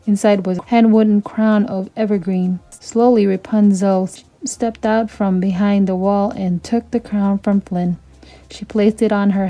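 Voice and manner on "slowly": ordinary delivery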